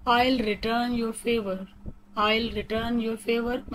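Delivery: very slow, words spaced out evenly; slow robotic reading. Shown as a demonstration. Teacher showing how to interrupt politely, slow and robotic. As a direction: lay out each word for learners. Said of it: "थोड़ा robotic वे में पढ़ के बता रही हूँ".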